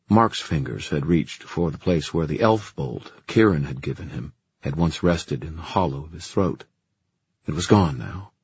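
plain reading voice

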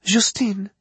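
put-on high young woman with French accent; worried Parisian woman, French accent. French vet assistant voiced.

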